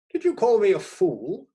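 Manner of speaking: high, loud retort; blustering editor. A narrator's reading. Editor mishears the critic, loud and high. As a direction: fire it back heartily.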